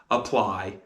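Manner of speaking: ordinary talk, nothing marked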